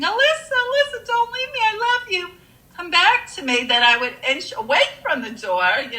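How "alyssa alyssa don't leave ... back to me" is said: high, pleading mock scream at normal volume; imitated begging. Mother flinging herself on the casket, imitated high-pitched begging. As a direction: beg like a distraught mother, retold. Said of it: "begging, screaming".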